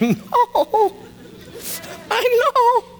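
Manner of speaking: very high, loud squeal of frustration; hammed-up high-pitched retort to nagging parents; exasperated mock yell. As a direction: squeal it back, stretched out. Exasperated driver answering yelling dad.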